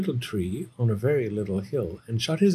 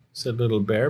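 ordinary delivery

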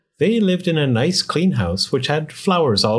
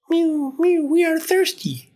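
very high, tiny kitten voice; mewing cats. A narrator's reading. Cats begging the old man for water, tiny mewing voice. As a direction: mew like a crowd of thirsty kittens. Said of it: "cried the hundreds of cats".

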